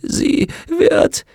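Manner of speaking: one word at a time, high and strained; gasping, broken fragments rising to a cry; tortured, halting speech. Acted. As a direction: force each word out through agony. Tortured witch forces out words.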